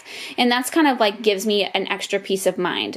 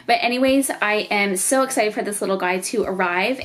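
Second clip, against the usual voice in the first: normal talking voice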